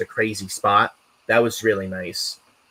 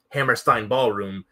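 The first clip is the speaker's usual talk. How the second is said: normal talking voice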